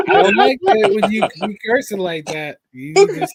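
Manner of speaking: high, loud scolding mother voice; imitating his mother. Son's mother scolding him for cursing, high and loud. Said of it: "She says, she's like".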